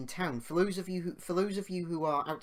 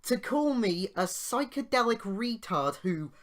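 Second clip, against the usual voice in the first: higher and louder, outraged retelling; indignant rant; 6 semitones higher, 5 dB louder than usual. Real, not acted. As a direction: fume about the cheek of it all. Indignant rant about the insult.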